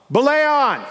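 high, loud call that carries. Retold or imitated. Belayer answers the climber above, calling out. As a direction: holler it up to the climber. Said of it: "my job is to call out".

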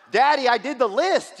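put-on high, loud childish brag to daddy; boasting kid voice. Kids bragging to their dad about the finished list, loud childish voice. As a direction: crow it like a kid who finished early.

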